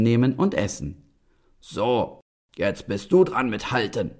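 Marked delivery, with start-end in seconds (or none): 1.6-4.1 commanding giant. Acted. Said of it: "befahl der Riese".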